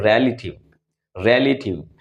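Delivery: रिलेटिव said carefully, with a buzzing वी at the end; correct pronunciation. Shown as a demonstration. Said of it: "इसे हम कहते हैं". (रिलेटिव का सही उच्चारण, वी में कंपन के साथ)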